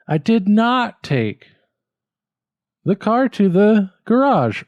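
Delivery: "garage" high and falling. Shown as a demonstration. Student tries ending stress. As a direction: finish hard on "garage". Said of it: "or should it be".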